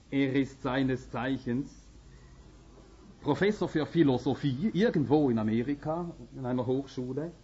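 normal talking voice

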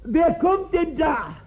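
high, slow, anxious call into the crowd; a blind beggar calling out to passing feet. Retold or imitated. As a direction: call out as if you cannot see who comes. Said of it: "Und dann ruft".

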